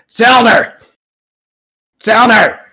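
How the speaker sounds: loud, high call across the shop. Acted. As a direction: shout for him from the back. Calling for shop owner.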